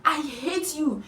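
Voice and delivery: imitated outburst, high and forceful; high-pitched retold rant; angry shouting. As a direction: lash out as the furious roommate. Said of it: "She just started shouting".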